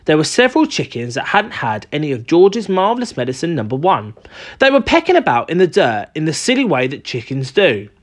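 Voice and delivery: ordinary delivery, nothing marked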